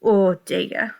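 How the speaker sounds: usual speaking voice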